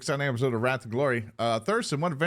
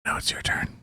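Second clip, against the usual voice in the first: whisper. Real, not acted. Whispered cue to next player.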